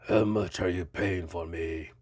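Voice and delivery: put-on low monster voice with a muddled Romanian-like accent; Frankenstein's monster. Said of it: "Was that a Romanian accent?".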